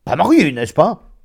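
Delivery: high, loud, eager question; pry jovially; hearty, nosy familiarity; boisterous old friend. Acted. Friend probes the narrator.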